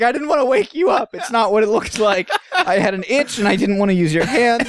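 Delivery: high-pitched mock-sheepish excuse. Caught scratching against friend, high sheepish excuse. Acted excuse of conjoined twin.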